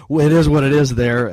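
real chuckle breaking into the words; amused laugh. Real, not acted. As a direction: laugh it off while speaking. Laughs off calling it a hospital.

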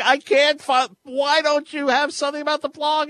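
very high, loud whine of protest; mock exasperated complaint. Host imitating listener hunting for the blog, high and exasperated. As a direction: wail it as if nothing can be found.

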